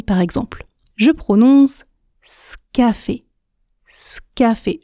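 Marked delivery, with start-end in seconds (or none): 2.2-4.8 "scafé" with s straight onto k; dropped E. Shown as a demonstration. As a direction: run "scafé" together, no vowel after s. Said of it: "Je ne prononce pas le E". (« scafé » sans prononcer le E)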